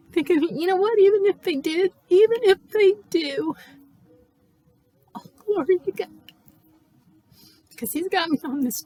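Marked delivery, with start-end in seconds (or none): 0.1-6.1 fervent faith. Retold or imitated. Retold faith declaration, rising fervor.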